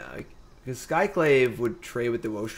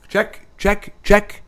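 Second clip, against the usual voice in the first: loud high mic check; 10 semitones higher, 9 dB louder, much slower than usual. Shown as a demonstration. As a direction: test the mic, punchy. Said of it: "you hear click".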